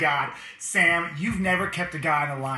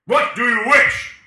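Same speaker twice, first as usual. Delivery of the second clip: booming genie voice; 6 semitones higher, 12 dB louder, slower than usual. Acted. Genie asks wish.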